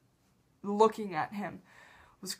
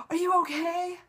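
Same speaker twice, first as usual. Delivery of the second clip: higher, cooing imitation of worry; kind concern; 8 semitones higher than usual. Fan imitating Johnny seeing her cry, kind concern. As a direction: ask sweetly in a raised pitch. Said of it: "he was so kind".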